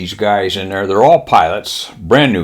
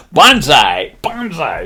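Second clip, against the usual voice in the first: higher mock banzai cry; Japanese kid's battle cry; 5 semitones higher, slower than usual. Young pilot starting a chant, mock banzai cry. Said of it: "one kid starts this".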